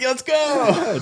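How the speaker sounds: high put-on hype voice; mock New York cheer; excited quote. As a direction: shout it like a local fan. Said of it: "I was like".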